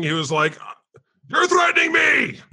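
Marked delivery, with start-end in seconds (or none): 1.3-2.4 high, loud imitated rant at a store; mock shout. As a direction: yell it like an angry Costco shopper. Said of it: "just like shouting".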